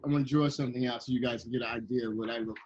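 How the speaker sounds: normal talking voice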